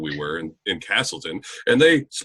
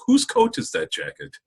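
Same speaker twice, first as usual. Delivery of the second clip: imitated Welsh speaker. Imitating a Welsh turn of phrase.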